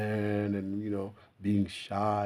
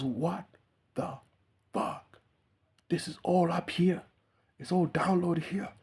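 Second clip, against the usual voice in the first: high-pitched, halting crazed revelation; losing his mind; 9 semitones higher than usual. Acted. Idiot who tapped into everything, high crazed amazement. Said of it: "So he goes crazy, loses his mind".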